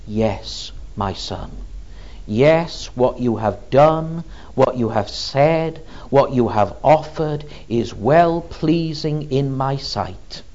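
hushed fatherly blessing; tender Father. Acted. God's words voiced, soft.

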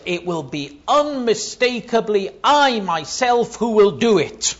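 high, loud stress on "I myself"; insistent divine proclamation, "unmistakably" punched; emphatic. Retold or imitated. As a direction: drive home "I myself" at full force. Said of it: "It's very emphatic".

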